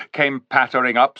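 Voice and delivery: ordinary delivery, nothing marked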